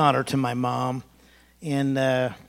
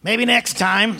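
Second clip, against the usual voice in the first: a hint of a smug scolding mom, higher and louder; mocking mother; 7 semitones higher, 7 dB louder, slower than usual. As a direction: tease the hurt child like his mother did. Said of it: "And here's my mother".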